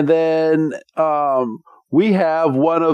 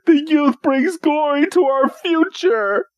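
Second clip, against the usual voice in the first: much higher, swooning grandeur; hammed-up, tearful nobility; over-the-top knight; 14 semitones higher than usual. Wickstrom proclaims the youth's glory, much higher and overwrought. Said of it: "And he's like".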